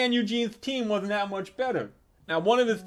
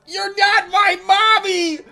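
put-on high, drawn-out loud kid scream; child's yell; 12 semitones higher, 9 dB louder, slower than usual. Nephew shoving the strange woman, high childish yell. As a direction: holler it like an outraged little boy.

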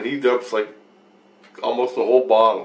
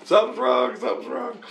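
mock yell; 12 semitones higher than usual. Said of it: "screaming and yelling".

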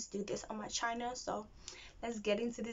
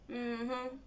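ordinary delivery

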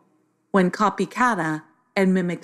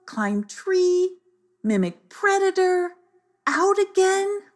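high, cheeky squawking parrot; high saucy birdlike voice; 14 semitones higher than usual. A narrator's reading. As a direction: sass the words back like a bird. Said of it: "in a very saucy voice".

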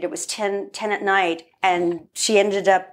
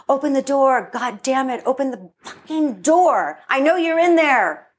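raised, higher-pitched yelling through a door; angry demand; 5 semitones higher than usual. Retold or imitated. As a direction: re-enact a furious demand to open up. Mom banging on bedroom door.

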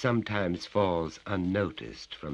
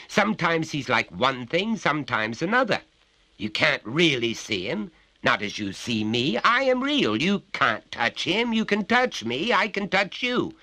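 higher, louder bullying father; menacing father; 11 semitones higher, 7 dB louder than usual. Acted. Father grips his son's neck, bullying and loud. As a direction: lean on the child with each claim.

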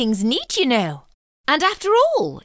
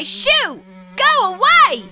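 shooing yell; 6 semitones higher than usual. Acted. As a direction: drive the fly off. Chasing fly away.

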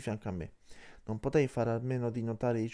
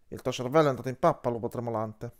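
louder, quick-fire scornful retort; angry outburst; 4 dB louder than usual. A narrator's reading. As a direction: blurt out the insult in irritation. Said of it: "sbottò Trito volando via".

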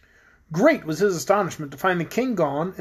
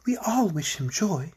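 airy character voice; 4 dB quieter, slower than usual. A narrator's reading. Polychrome's voice in story.

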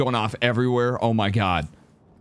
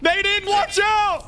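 high, slow drawn-out warning shout; grenade warning yell; slower than usual. Real, not acted. Grenade lands during firefight.

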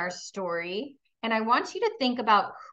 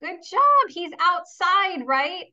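much higher, louder cheer of good job; enthusiastic praise; 7 semitones higher, 5 dB louder than usual. Acted. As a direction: reward the kids with big delight. Praising pupils' answers.

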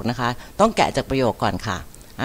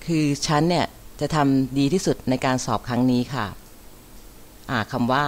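ordinary talk

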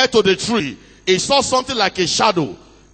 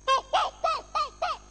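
high hooting bird cry; bird call; 6 dB quieter than usual. A narrator's reading. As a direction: hoot it like a night bird in the trees. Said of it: "he heard the bird crying in the tree".

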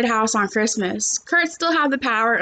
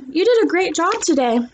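higher, lightly voiced nurse; a touch of a kindly nurse; 6 semitones higher than usual. A narrator's reading. Nurse praising the boy, lightly voiced. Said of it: "the nurse said".

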